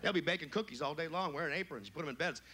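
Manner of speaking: ordinary talk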